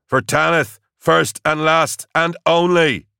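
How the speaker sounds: high, loud shout with drawn-out final words; full-throated, high war cry; screamed battle cry. A narrator's reading. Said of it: "he screamed".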